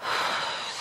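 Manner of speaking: a long, soft, unvoiced breath out; slow calming exhale. Shown as a demonstration. Student steadying before an exam with a calming exhale. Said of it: "take a deep breath".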